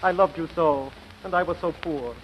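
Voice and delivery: soft, sinking regret; sorrowful farewell. Acted. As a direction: say a heartbroken goodbye, fading away. Rejected suitor's sorrowful farewell.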